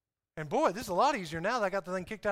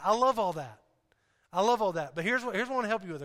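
ordinary delivery

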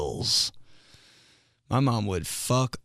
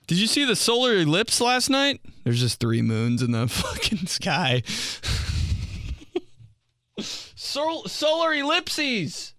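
mock reporter; 10 semitones higher than usual. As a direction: play a clueless reporter. Mock news character.

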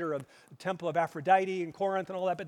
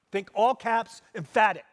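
punched out loud and high-energy; loud emphasis; 3 semitones higher, 9 dB louder than usual. Shown as a demonstration. Preacher explains Paul's Greek to the church, loudly emphatic. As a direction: hit it like all caps.

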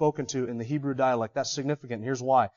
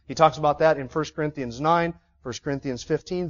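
ordinary talk, nothing marked

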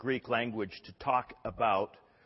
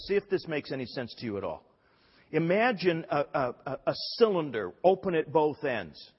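ordinary delivery

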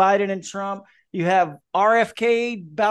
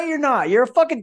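normal talking voice

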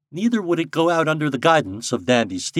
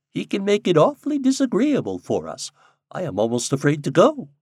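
ordinary delivery, nothing marked

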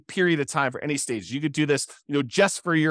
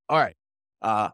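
throat catching on the words; tickle in throat; 5 semitones lower than usual. Real, not acted. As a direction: talk past a tickle in the throat. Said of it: "as I have a tickle in my throat".

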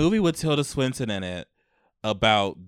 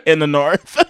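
loud, higher mock northern accent; 4 semitones higher, 8 dB louder than usual. Imitating northerners' talk, northern accent. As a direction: put on a big northern accent. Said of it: "What do they say".

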